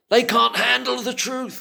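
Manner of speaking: high, loud movie-line quote. Retold or imitated. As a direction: deliver it like the famous film line. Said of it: "I've always wanted to say that".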